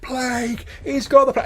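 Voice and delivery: high, loud panicked screech. Acted. Bystander warning the crowd, screeching in panic. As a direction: scream it in terror as you run. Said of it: "and screeches out".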